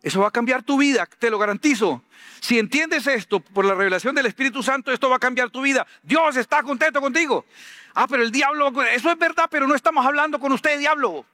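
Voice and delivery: high, driving full-force preaching; fervent preaching. Acted. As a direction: preach it to the crowd with fire.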